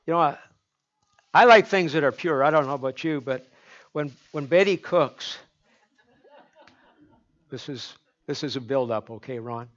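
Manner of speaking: usual speaking voice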